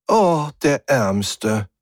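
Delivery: high, slow mock pity. A narrator's reading. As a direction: draw out the false sympathy. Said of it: "sagte die Stimme höhnisch". (höhnisch, gespielt mitleidig)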